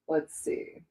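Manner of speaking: whispering to herself; whispered. Real, not acted. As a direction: breathe it out unvoiced. Musing under her breath.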